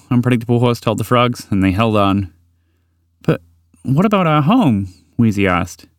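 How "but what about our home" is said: higher, anxious little voice; a small frog fretting about home; worried small creature. Acted. Wheezy asks the horse about home, anxious and high.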